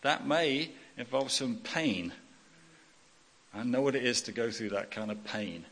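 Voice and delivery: usual speaking voice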